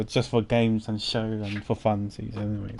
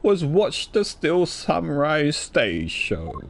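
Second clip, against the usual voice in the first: slow, drawn-out suspect voice with a chuckle; slow theatrical voice; 5 semitones higher, slower than usual. Acted. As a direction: draw out every word like a scheming suspect.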